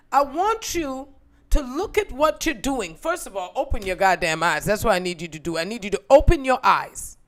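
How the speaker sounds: loud, firm scolding mother; mother scolding a daughter over the bathroom, loud and stern. Retold or imitated. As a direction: lay down the law to your daughter. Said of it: "mother scolding her".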